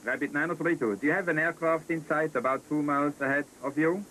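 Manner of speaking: loud, urgent controller query; urgent radio call. Real, not acted. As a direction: press the pilot hard over the radio. Urgent query after radar loss.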